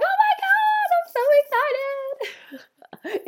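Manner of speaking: high shrieking oh my God, so excited; high-pitched delighted squeal; squealing excitement. Retold or imitated. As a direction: squeal it like thrilled news. Retold excitement at news.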